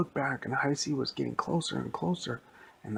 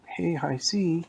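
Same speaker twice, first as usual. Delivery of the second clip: loud, high call for help; 8 semitones higher, 5 dB louder, slower than usual. Retold or imitated. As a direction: shout it across the playground. Kids summon the mascot.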